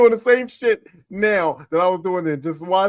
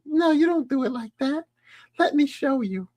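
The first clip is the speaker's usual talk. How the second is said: coaxing mom; 10 semitones higher than usual. Retold or imitated. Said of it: "The mom's like".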